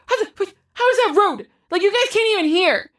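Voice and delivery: high, fast disbelieving protest; indignant teen. Retold or imitated. Ruby objecting to her mom, high and indignant. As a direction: squeak out the outrage at mom. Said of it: "And she's like".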